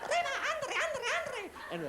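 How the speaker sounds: mock Spanish cheer. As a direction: cheer in a cartoon Spanish accent.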